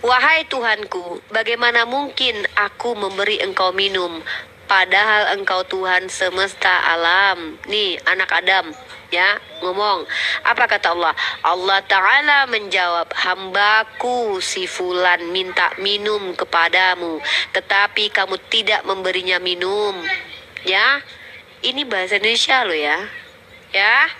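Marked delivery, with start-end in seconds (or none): 0.0-7.6 slow, measured scripture reading; solemn slow recital. Acted. To the debater, reciting the servant's plea slowly. 13.1-19.9 slow, measured scripture reading; solemn slow recital. Acted. To the debater, quoting God's reply slowly.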